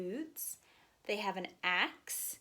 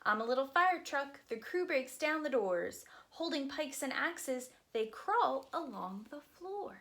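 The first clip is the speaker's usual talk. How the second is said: lively, sing-song picture book reading; reading to children; 6 semitones higher, 4 dB louder than usual. Acted. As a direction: read it aloud to a group of little kids.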